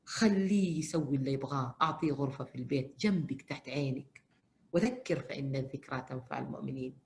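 ordinary talk

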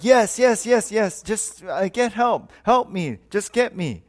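high, urgent string of pleas; acted frantic pleading. Hanging man in a joke pleads with God, mock frantic. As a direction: beg to be rescued, words tumbling out.

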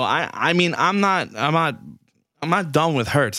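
usual speaking voice

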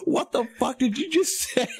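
very high, aghast mimicry; mock the stunned drivers' reaction; imitated shocked disbelief. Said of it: "their eyes got all big".

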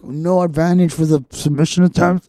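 loud, high-pitched imitation; mock ranting. Imitating a ref's nonsense call, mock ranting.

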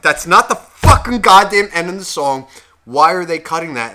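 heated, escalating retold rant; pissed-off outburst. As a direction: blow up over it, retelling the outrage. Retelling his pissed-off complaint.

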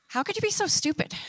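high, sneering voice of self-doubt; mock the listener as the lie in their head; inner critic voice. Voicing the lies we tell ourselves, high and sneering.

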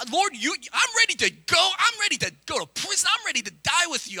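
a hint of Peter bragging; boastful Peter. Pastor voicing boastful Peter.

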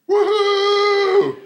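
very high, loud woohoo; joyful whoop. Retold or imitated. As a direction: whoop with wild joy. Rejoicing while crashing.

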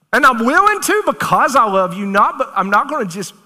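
high, loud and urgent; pleading confrontation. Pretend. Preacher confronting a loved one, loud and urgent. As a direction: shake them awake with your voice. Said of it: "like I'm trying to get through to you".

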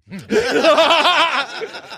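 a burst of high delighted laughter. Real, not acted. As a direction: laugh out at your own punchline. Laughing at his own punchline.

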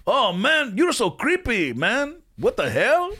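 high, swooping snow monkey voice. Shown as a demonstration. Actor riffing on the snow monkey's line, comic impression. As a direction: play an exaggerated high-pitched comic complaint. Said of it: "sound a little bit like [name]".

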